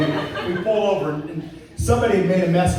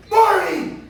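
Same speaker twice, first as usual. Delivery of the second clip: high, loud call across the track; yelling a name; 13 semitones higher, 3 dB louder than usual. Retold or imitated. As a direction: call her name out from far away. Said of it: "And she's like".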